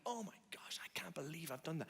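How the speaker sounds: hushed, whispered dismay; soft voiceless lament; acted weepy disbelief. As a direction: whisper it as if close to tears. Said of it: "makes you just weep".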